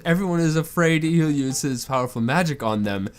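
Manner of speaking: ordinary talk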